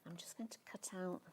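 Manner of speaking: soft whisper to herself. Real, not acted. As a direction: murmur it under your breath. Concentrating while cutting felt.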